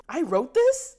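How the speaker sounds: high, laughing question; embarrassed at her own words; high incredulous laugh; laughing disbelief. Real, not acted.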